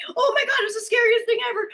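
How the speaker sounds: high, hammed-up roller-coaster panic; mock thrilled terror. Rider on a roller coaster to anyone listening, mock terror. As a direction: play a rider scared and loving it. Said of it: "screaming the whole time".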